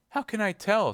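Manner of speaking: put-on high, agitated girl's voice; Kitty, distressed. A narrator's reading. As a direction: blurt it out high and helpless.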